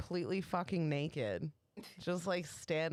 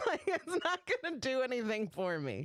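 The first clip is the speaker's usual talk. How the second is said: giggling through the words; laughing while talking; 7 semitones higher than usual. Retold or imitated.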